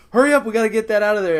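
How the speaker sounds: fast, high and loud urgency; hammed-up frantic hurry; mock panic. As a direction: rush it out in pretend alarm. Imagined panicked partygoer.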